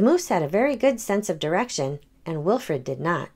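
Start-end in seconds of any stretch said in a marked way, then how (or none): none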